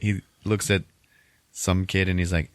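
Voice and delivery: ordinary talk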